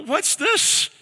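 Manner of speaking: high-pitched puzzled question; mock bewilderment, voice way up; baffled reaction. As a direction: act out being thrown off by a stranger. Drunk man reacts to cheery call.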